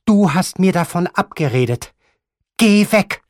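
high, loud, imperious grandmother; imperious grandmother sending him off, high and loud; angry old grandmother. Acted. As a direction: snap at him like a furious old lady. Grandmother voiced in the story.

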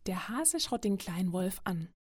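ordinary delivery

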